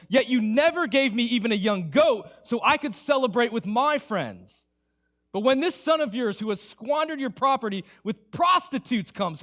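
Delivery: loud, resentful protest of a wronged son; bitter complaint. Acted. Older son's bitter complaint to father.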